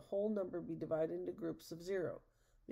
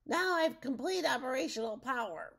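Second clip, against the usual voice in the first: high, loud villain's boast; triumphant yell; 7 semitones higher, 6 dB louder than usual. A narrator's reading. Rumpelstiltskin reattaches the frog crystal, high triumphant yell. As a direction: crow it out at a raised pitch. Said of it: "Rumpelstiltskin yelled".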